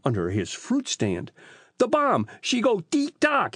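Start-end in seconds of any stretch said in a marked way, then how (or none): 1.8-3.6 put-on high-pitched Italian-accented wail. A narrator's reading. Rocco implores the police to save him, high wail. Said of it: "he wailed".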